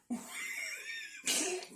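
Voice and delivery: a sudden high squeal of laughter; delighted squeal of laughter at the cat. Real, not acted.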